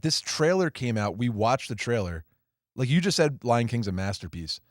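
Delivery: ordinary talk, nothing marked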